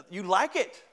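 proud, playful dad rising at the end; showing-off dad. Acted. Dad to his boasting kids, showing off.

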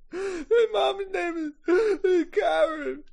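slow, high-pitched sobbed answer; Teddy crying. Acted. Teddy answering the man who asks his mom's name, crying. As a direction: choke it out between hammed-up sobs. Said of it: "He's crying, or snot coming out".

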